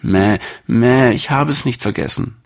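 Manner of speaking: high little lamb voice, bleating, sincere; the lamb owning up, earnest and small; honest lamb bleat. Acted. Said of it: "murmelte das Schäfchen ehrlich". (hohe Schäfchenstimme, ehrlich und leise murmelnd)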